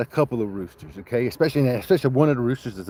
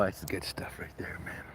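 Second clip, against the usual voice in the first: whisper; 6 semitones lower, 18 dB quieter than usual. Real, not acted. Savoring the rooster fights.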